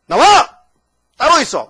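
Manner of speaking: loud, high barked order; scolding. Retold or imitated. Paul scolding the Corinthian church for following idols, loud scolding. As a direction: snap out a sharp command. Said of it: "막 야단쳤다고요".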